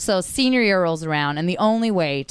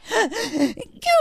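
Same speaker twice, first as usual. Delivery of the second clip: sharp high gasp of dismay; shocked gasp. Retold or imitated. Cut player gasping at the bad news. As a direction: gasp like the floor dropped out. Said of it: "And I'm like".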